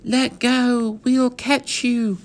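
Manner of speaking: high call upward to the cupboard; girl shouting to the falling cowboy, high and urgent. A narrator's reading. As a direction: shout up to him, slow and clear. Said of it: "she shouted up".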